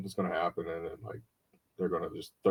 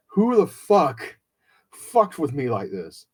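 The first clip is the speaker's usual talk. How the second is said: slow, much higher and louder, disbelieving fury; outraged cry; 6 semitones higher, 7 dB louder, slower than usual. Real, not acted. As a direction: drag each word out in raw outrage. Fury at whoever leaked it.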